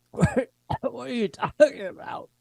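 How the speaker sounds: high, soft, laughing bewildered voice; dazed soldier. Acted. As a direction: play a groggy man who can't follow. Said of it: "she hears him in reality saying".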